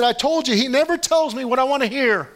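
high, dripping sarcasm; mock him with a sneering whine; sarcastic king mocking the prophet. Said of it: "He's being sarcastic now".

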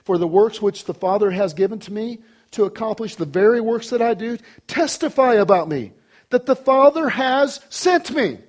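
high, building proclamation; preached scripture reading. Acted. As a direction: build to a ringing finish.

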